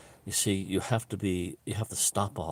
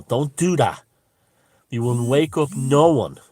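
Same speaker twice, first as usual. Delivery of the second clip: much louder and higher, a real warning; loud scolding; 7 semitones higher, 11 dB louder than usual. Real, not acted. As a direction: tell them off hard, all in earnest. Scolding viewers against ranting.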